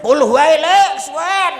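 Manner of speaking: high, loud mock whine; mimicking the audience. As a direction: complain it's too long in a squeaky voice. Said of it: "ngono to kowe".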